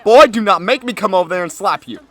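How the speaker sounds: loud threatening scold; whisper yelling. Real, not acted. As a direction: warn him off like an annoyed parent. Threatening intruder.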